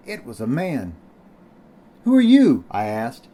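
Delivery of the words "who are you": high, loud demand; startled question. A narrator's reading. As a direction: call it out, startled and much higher. Startled question to a strange man.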